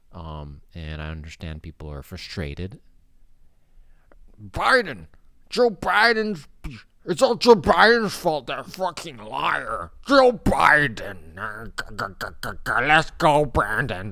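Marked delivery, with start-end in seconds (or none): 4.4-14.1 high, loud, slow caricature shouting blame; hammed-up furious complainer; mock angry rant. Mocking angry people blaming the president, high and loud.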